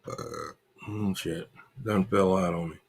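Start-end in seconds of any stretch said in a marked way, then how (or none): none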